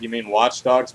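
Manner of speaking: usual speaking voice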